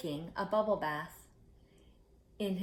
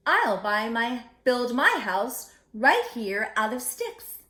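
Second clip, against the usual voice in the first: louder, eager squeal; excited squeal; 3 semitones higher, 7 dB louder than usual. Acted. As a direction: squeal it with glee. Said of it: "Boris squealed excitedly".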